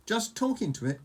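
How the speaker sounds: high-pitched, incredulous echo; astonished. A narrator's reading. Fishlegs echoes Hiccup's admission, astonished. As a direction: repeat it back in disbelief. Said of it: "gasped Fishlegs in astonishment".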